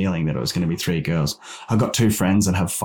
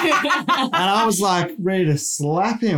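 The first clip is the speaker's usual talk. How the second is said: laughing through the words, pitched up and slow; 10 semitones higher than usual. Real, not acted. Dad to the podcast hosts, laughing while recalling the sonographer. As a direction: laugh it out as you tell it.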